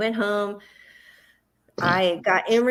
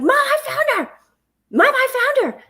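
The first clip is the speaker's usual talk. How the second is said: mock child's shout, much higher and louder; excited shout; 6 dB louder than usual. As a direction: call it out high like a relieved little boy. Son announces he found her.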